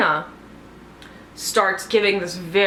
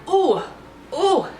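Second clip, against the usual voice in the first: high, short mock-alarmed oohs; suspicious "ooh"; 10 semitones higher, 3 dB louder than usual. Viewer reacting as suspicion lands, alarmed oohs. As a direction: let out two high oohs of dawning suspicion. Retelling her nervous suspicion.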